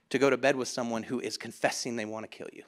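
low, soft and level; sober disbelief. Real, not acted. Preacher reflecting on Delilah's bed, sober and hushed. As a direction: let it drop low and quiet.

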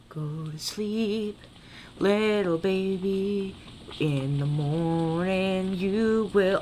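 slow, soft, eerie sing-song; creepy lullaby voice. Pretend. Player voicing a creepy ghost to his character, slow and eerie. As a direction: croon it like a haunting ghost.

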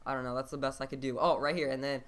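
ordinary talk, nothing marked

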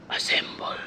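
mock hero whispering the command; whispered. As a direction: breathe the word like a secret. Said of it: "Bakit niya binulong?".

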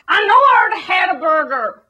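loud, high, whining burger demands; hammed-up crying shout of a greedy American; mock American. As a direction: bawl for food like a spoilt glutton. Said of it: "Americans are all like".

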